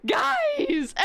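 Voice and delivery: high, helpless plea through laughter; real laughing disbelief. Real, not acted. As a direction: appeal to the audience while cracking up. Cracking up at orc lore.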